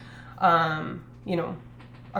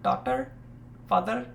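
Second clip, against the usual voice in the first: put-on Indian accent; 4 semitones higher than usual. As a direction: lightly mimic an Indian accent. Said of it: "in the Indian accent".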